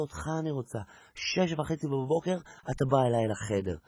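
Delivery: soft, husky come-on; soft seductive murmur; seductive whisper. Acted. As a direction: breathe it seductively. Beauty seduces.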